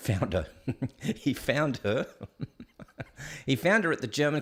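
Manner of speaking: laughing through the words; amused laugh breaking the words. Real, not acted. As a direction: chuckle while repeating it. Laughing at dad finding Patricia.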